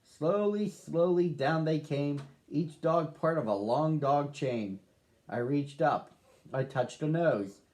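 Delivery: usual speaking voice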